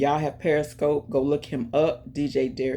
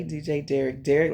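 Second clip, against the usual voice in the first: usual speaking voice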